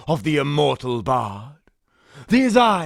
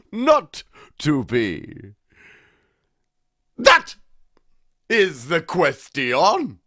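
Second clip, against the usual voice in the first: very slow, rising, swelling declamation; hammed-up Shakespeare; 5 semitones higher, much slower than usual. Shown as a demonstration. Old actor performs Hamlet's soliloquy, slow and grand. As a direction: declaim like an old ham tragedian. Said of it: "Allow me to demonstrate".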